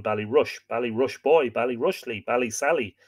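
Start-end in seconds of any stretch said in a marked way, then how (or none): none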